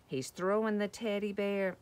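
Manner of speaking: ordinary delivery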